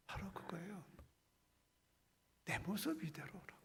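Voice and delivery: unvoiced hushed aside; whisper. Real, not acted. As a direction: breathe it in a whisper. Whispered after hymn. (속삭이듯)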